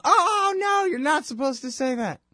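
high, pearl-clutching "oh, no"; a hint of a prissy scold; mock scandalized gasp. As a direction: play the shocked hall monitor, pitch up. Mocking those who object to Nazi comparisons.

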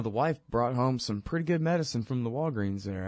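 ordinary talk

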